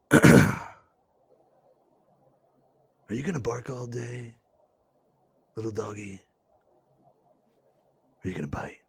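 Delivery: low, soft, shady gangster threat; shady gangster taunt. Shown as a demonstration. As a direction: bring in the shadiness, quiet and low. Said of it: "say it like this".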